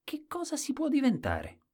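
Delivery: very high, thin puzzled voice; squeaky innocent question; Colombin. A narrator's reading. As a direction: ask high up, like a naive fool. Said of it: "E Colombin domandò".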